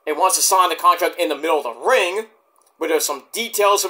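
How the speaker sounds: normal talking voice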